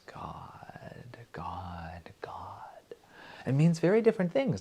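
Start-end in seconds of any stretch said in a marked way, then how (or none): 0.1-2.6 slow unvoiced whisper. Shown as a demonstration. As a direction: whisper it tenderly, slowly. Whispered verse ending.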